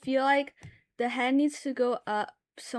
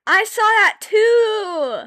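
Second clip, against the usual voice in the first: loud, high and drawn out; excited shout of agreement with chat; 9 semitones higher, 10 dB louder, much slower than usual. Real, not acted. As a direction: shout it in delighted recognition. Excited recognition of shared video.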